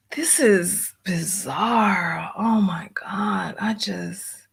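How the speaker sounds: breathy, slow whisper of disbelief; slow stunned whisper at the screen; exasperated whisper. Real, not acted. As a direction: mutter it under your breath, fed up. Frustrated streamer, page vanished.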